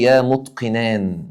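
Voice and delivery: يا متقنان drawn out slowly; slow demo. Shown as a demonstration. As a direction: stretch the call for the class. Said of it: "يقول لي". (نداء بطيء وممدود)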